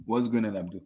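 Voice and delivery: ordinary delivery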